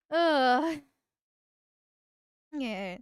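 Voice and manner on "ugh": disgusted groan. Real, not acted. Disgusted reaction sound.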